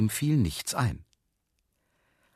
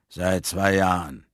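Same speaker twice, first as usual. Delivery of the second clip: slow, lower man's voice; fat man; 4 semitones lower, slower than usual. A narrator's reading. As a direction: answer low and drawn out. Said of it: "sagte der Mann". (dicker Mann, tief und langsam)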